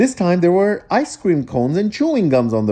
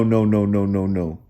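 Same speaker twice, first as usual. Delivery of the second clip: ordinary delivery, nothing marked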